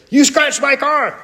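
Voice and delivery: very high, hammed-up screech of accusation; mock yelling. Woman repeats her charge to calm grandson, hammed-up yelling. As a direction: parody an outraged car owner. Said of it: "this yelling match".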